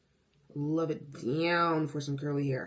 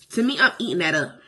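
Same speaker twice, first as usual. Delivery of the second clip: sassy drag queen; 8 dB louder than usual. Acted. Hyping her look.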